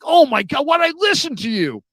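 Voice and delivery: high-pitched mock outrage; losing it. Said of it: "My brother is losing his S".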